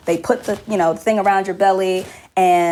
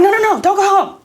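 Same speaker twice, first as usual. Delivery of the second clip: high, louder mock alarm; mock panicked residents; 12 semitones higher, 4 dB louder than usual. A mother mocks frantic residents begging her to stay, high and alarmed. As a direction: squeal it like the flustered residents.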